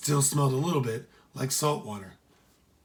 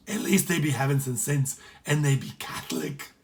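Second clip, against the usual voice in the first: a hint of Irish brogue; grudging approval in Irish; light Irish pirate voice; Irish accent. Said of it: "his Irish companion".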